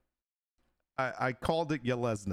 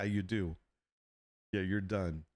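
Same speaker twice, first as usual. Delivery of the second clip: ordinary delivery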